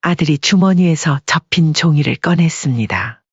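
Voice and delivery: plain reading voice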